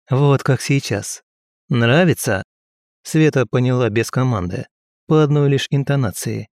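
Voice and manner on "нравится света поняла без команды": usual speaking voice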